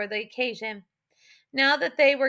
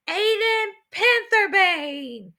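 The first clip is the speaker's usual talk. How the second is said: high, slow ceremonial shout; 8 semitones higher, 4 dB louder, much slower than usual. A narrator's reading. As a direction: call the name out to the crowd. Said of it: "he shouted".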